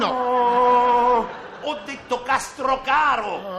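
loud long no, then CASTROCARO slow and stretched; emphatic correction. Acted. Teacher correcting the contestant's "costa caro", loud slow CASTROCARO. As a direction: shout CASTROCARO out as one drawn-out name.

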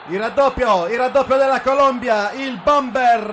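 loud rising goal call; breathless italian goal commentary. Real, not acted. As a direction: call the second goal with a shout.

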